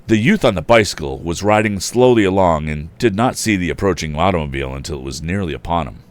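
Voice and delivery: loud, animated storybook reading; story narration. A narrator's reading. Narrator reading to listeners, animated and loud. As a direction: tell the tale with gusto.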